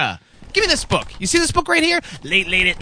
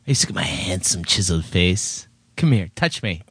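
much lower, hammed-up seductive voice; mock seduction. Co-host teasing the host with mock seduction. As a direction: purr it like a cheesy lover, joking.

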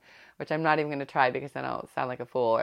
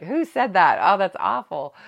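higher, much louder, appalled scolding parent; shocked parent; 5 semitones higher, 8 dB louder than usual. Retold or imitated. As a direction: play the horrified mom reacting to a kid's new phrase. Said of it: "I find myself saying it too".

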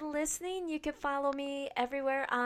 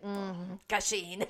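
sultry voice; 6 semitones lower, much slower than usual. Shown as a demonstration. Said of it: "you'll be saying the same sultry voice".

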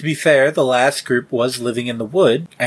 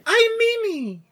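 very high squeaky greeting; bright squeaky sing-song welcome. Acted. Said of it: "A little bit higher".

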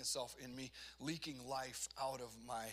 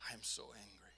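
retold anger admission; 6 semitones lower, 6 dB quieter than usual. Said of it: "until I was like".

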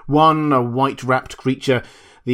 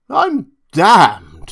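put-on loud, high drunken curse; drunken outburst; 6 semitones higher, 9 dB louder than usual. A narrator's reading. Drunk doctor struck by an idea, loud drunken curse. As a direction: stagger up and swear. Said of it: "said he, staggering".

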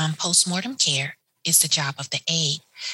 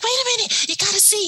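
much higher and louder, pleading excitement; eager urging; 3 dB louder, faster than usual. Retold or imitated. Aide begging the nurse to come see, eager and high. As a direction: grab her arm with your voice, she has to see.

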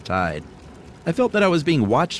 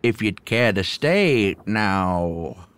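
put-on creaky old hermit; much slower than usual. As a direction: play the awkward old hermit. Voices the hermit.

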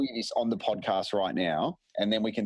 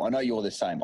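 normal talking voice

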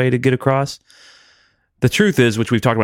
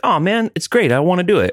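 eager; 8 semitones higher than usual. Retold or imitated. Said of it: "for him to be like".

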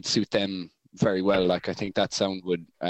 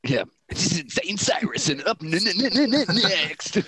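high, stammering radio host voice; stuttering radio DJ; 9 semitones higher than usual. Pretend. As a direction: announce it like a DJ who keeps stalling on next. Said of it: "introducing them on a radio station".

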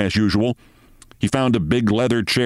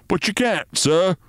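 much higher, pleading; anxiously; 9 semitones higher than usual. A narrator's reading. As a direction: protest in alarm. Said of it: "said the sergeant anxiously".

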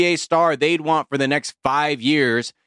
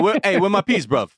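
put-on London roadman accent; 8 semitones higher than usual. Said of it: "I'm on Top Boy right now".

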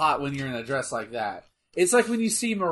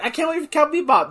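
higher, quick read of an eager tweet; mock eagerness; 9 semitones higher, faster than usual. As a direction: read it out with put-on excitement.

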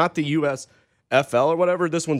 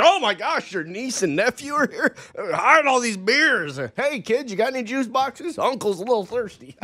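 sneaky uncle voice; 7 semitones higher than usual. Retold or imitated. Hiding beers from kids, acted.

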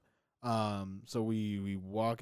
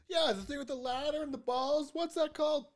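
much higher, put-on clueless questioner; high-pitched mock puzzlement; mock describer. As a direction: squeak it out in a far higher voice. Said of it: "the description was".

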